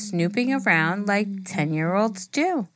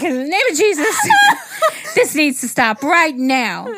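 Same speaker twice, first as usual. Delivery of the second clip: loud, higher mock exorcism shout; hammed-up yelled command; 7 semitones higher, 6 dB louder than usual. Co-host acts out a ten-year-old yelling at spirits, hammed-up yell. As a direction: belt it out as a ten-year-old's righteous command. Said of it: "She's ten years old. She's like".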